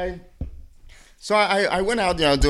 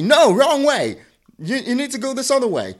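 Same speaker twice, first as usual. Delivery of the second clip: high, loud mock yell; imitated yelling; 8 semitones higher, 7 dB louder than usual. Retelling a passenger correcting the driver's turn, mock yelling. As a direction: yell it like an irate passenger, retold. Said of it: "she starts yelling at me".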